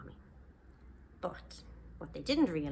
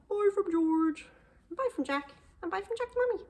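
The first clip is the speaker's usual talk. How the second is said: high, sing-song voices saying bye; character goodbyes; 13 semitones higher, 5 dB louder than usual. Acted. Narrator signing off for George, Jack and mummy. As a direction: wave off listeners in character.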